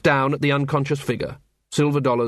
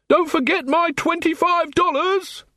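shouting; slower than usual. A narrator's reading. Robbed man calls after departing officers, mock shout. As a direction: call after them in a very high voice. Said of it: "he shouted".

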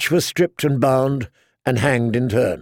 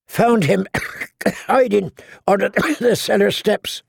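coughing; 7 semitones higher than usual. A narrator's reading. Jack reports to his companions after the burning septry, coughing. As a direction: speak through bursts of coughing, pitched high.

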